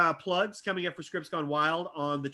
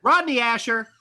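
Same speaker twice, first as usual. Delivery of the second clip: raised, loud announcer call; big curtain-call introduction; announcer roll call; 6 semitones higher, 8 dB louder than usual. Real, not acted. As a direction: present the performer to applause. Host's roll call of the cast.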